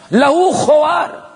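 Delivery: stretched slow and high, like a calf lowing; drawn-out lowing. Shown as a demonstration. Preacher voicing the golden calf's lowing, stretched slowly and high. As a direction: pull لہو خوار out long and high. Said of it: "وہ وقت آواز بھی تھی لہو خوار".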